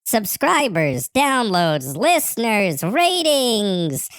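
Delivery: each item exclaimed, pitch swooping up to a long high RATINGS; extra emphasis. Acted. Reading a promoter's message to co-host, extra emphasis on SUBSCRIBERS and RATINGS. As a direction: read SUBSCRIBERS, DOWNLOADS, LISTENERS, RATINGS with exclamation marks. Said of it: "you added some extra emphasis on that".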